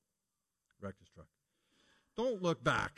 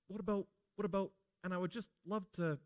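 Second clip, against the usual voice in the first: longing wife; 4 semitones higher than usual. Acted. Wife longing, looking back.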